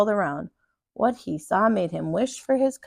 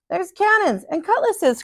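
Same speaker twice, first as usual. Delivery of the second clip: excited cry; 9 semitones higher than usual. A narrator's reading. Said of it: "cried Dots".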